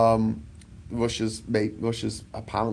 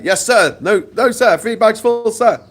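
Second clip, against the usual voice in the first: high, loud grovelling yes sir; mock servile yes-man; 12 semitones higher, 11 dB louder than usual. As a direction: bark it out like an eager lackey. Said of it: "And America's like".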